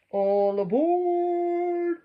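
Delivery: long, high, loud drawn-out call; booming conductor's call, stretched out; conductor crying out. Acted. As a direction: call the whole train aboard, slow and big. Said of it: "the conductor cried out".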